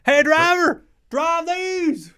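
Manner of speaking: very high, loud yelled line; Talladega Nights quote. Pretend. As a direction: bellow it like a wild movie character. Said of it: "reference to Talladega Nights".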